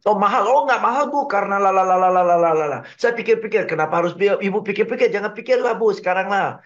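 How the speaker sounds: pushy salesperson. Acted. Salesperson answering a customer's price objection, pushy. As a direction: play an over-talkative salesperson steamrolling every objection. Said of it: "sampai terkesan pushy ya".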